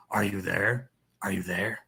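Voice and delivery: hint of a low criminal voice. Reader auditioning green phone's dealer, low criminal voice. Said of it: "he'll be like the criminal voice".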